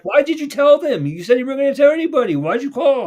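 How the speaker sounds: high, loud, fast reproach; upset accusation. Retold or imitated. Girl confronting the one who called the cops, upset accusation. Retold accusation of breaking a promise.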